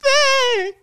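slow, very high drawn-out squeak; high-pitched. Pretend. Player answers the teasing question back, high-pitched. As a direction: stretch it out way up high. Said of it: "You wanna do high pitch".

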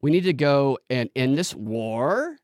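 slow, with WAR swung up like a question; upspeak. Shown as a demonstration. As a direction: make the statement end questioning on WAR. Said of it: "ends with a question mark almost".

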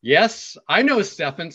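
retold shout back. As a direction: answer the stage from the crowd. Retold answer shouted back to stage.

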